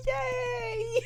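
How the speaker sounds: high, small squealed cheer; mock cheer. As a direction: cheer softly in a squeaky pitch. Mock cheer for cute killer.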